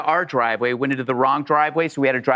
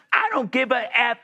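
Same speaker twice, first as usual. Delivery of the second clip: higher-pitched mock rant; retold screaming; 7 semitones higher than usual. Woman threatens the lost driver with jail, mock screaming. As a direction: imitate an irate woman, without truly screaming. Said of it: "She's screaming and screaming".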